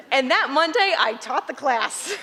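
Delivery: laughing through the words. Real, not acted. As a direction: speak while laughing.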